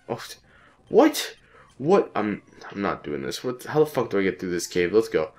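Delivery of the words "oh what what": very high yelped question; two quick yelps of real disbelief; shocked. Real, not acted. Player caught off guard in the game, shocked.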